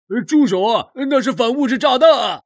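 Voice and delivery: high-pitched, frantic shout; panicked warning. Acted. Onlooker begging him to stop hammering a bomb, panicked. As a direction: cry out in alarm.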